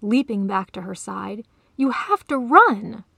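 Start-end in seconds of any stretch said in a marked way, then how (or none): 1.8-3.0 loud, slow, higher-pitched "You have to run"; "run" cried out high and loud; roared urging. A narrator's reading. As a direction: urge with force, landing hard on "run". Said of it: "the lion roared".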